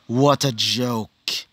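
slow, dismissive sneer; slow mocking contempt; cold scoff. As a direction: drag it out like the fight bores him. Bell mocks his beaten rival.

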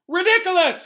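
high, loud cry; ringing high-pitched shout; cried out spell. A narrator's reading. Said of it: "cried Parvati".

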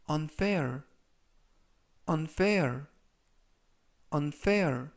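unfair said as "un-fair", stress on fair; pronunciation model. Shown as a demonstration. Teacher modelling unfair for learners. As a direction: say unfair clearly, three times. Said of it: "이라고 발음하시면 되겠습니다".

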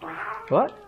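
normal talking voice

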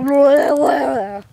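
loud, garbled blah noises; imitated camera jostling. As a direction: mimic a wobbly camera with your voice. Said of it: "so the camera's not like".

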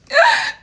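high, loud real laugh; burst of laughter. Real, not acted. Daughter laughing at her mom's reply, real laughter.

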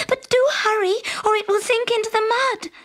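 high, quick, hurrying princess; impatient princess. A narrator's reading. Princess hurrying the frog to fetch her ball. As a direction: urge him on, high and fast. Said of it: "cried the princess".

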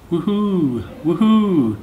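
loud, high ghost wail; ghostly woohoo cry. A narrator's reading.

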